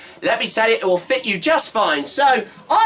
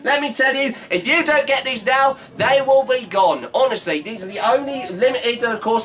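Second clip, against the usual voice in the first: normal talking voice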